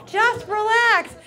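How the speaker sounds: loud, high, slow "just relax"; exasperated order. Acted. Man to an upset woman, loud and exasperated. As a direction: stretch it out at full volume.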